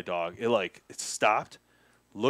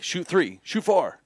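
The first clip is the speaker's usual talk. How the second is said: eager Canadian local; 7 semitones higher, slower than usual. Retold or imitated. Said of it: "All the Canadians, they were like".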